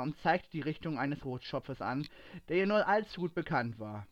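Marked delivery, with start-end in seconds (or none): none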